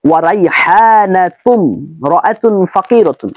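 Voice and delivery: slow, with রাইহানাতুন running straight into রাআতুন and the alif of ইমরাতুন left silent; careful Arabic pronunciation. Shown as a demonstration. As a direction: say রাইহানাতুন রাআতুন as one linked phrase, slowly. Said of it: "উচ্চারণটা কিভাবে করেছি একটু খেয়াল করুন". (ধীরে, রাইহানাতুন আর রাআতুন জুড়ে দিয়ে উচ্চারণ)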